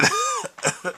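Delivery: a real burst of high-pitched laughter; cracking up at the caller's rant; squeaky high laugh. Real, not acted.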